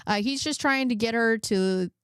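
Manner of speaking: ordinary talk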